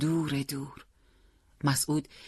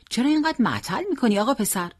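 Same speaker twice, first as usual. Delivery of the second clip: quick, higher-pitched hurrying question; impatient driver; 9 semitones higher, 4 dB louder, faster than usual. A narrator's reading. Taxi driver to a dawdling boy, impatient. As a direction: hurry the passenger along impatiently.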